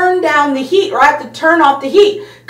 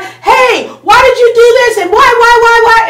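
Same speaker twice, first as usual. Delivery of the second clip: mock angry yell, higher and louder; 7 semitones higher, 5 dB louder than usual. Someone coming at you hard, a mock furious yell. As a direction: act out an upset person huffing and puffing. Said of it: "they're yelling and they're mad".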